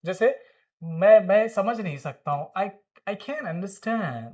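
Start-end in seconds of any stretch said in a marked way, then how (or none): 3.1-4.3 "can't" with American open a, slowly; American pronunciation. Shown as a demonstration. Teacher models an example for students, slow American vowel. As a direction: stretch "I can't understand", t of "can't" dropped. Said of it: "American they say can't".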